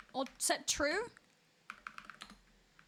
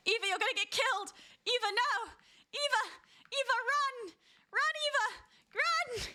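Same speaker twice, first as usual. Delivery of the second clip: urgent warning call; 7 semitones higher than usual. Real, not acted. Warning a player about a killer.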